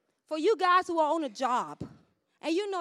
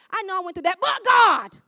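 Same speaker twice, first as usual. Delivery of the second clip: raised voice bursting into a triumphant shout; shouted testimony; 6 semitones higher, 10 dB louder than usual. Acted. Church member encouraging someone struggling, building to a shout. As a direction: build to a high, loud cry.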